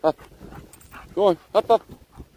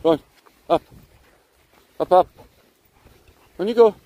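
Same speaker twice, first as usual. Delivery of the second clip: loud high call to the dog; 5 semitones higher, 5 dB louder, faster than usual. Real, not acted. Urging dog up hill.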